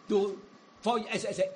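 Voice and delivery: normal talking voice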